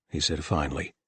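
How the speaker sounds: ordinary delivery, nothing marked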